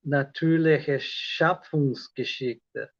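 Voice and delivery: slow, deliberate reading of Natürliche Schöpfungsgeschichte; careful German pronunciation. Shown as a demonstration. Learner showing viewers his German, slow careful pronunciation. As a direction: sound out Natürliche Schöpfungsgeschichte syllable by syllable, slowly. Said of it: "I'm gonna try to pronounce".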